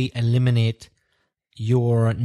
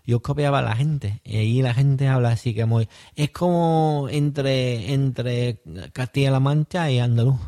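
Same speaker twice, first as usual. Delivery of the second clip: Extremaduran accent. Shown as a demonstration. Teacher shows his co-host his first-year Spanish, Extremaduran accent. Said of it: "I'm gonna go all funny now".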